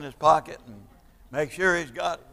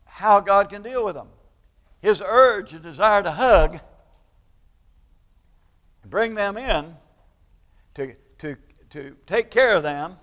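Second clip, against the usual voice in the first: louder and higher, a preacher warming to his point; fervent preaching; 4 semitones higher, 6 dB louder than usual. Acted. Pastor pressing the congregation on God's love, fervent preaching. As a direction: build the sermon with rising conviction.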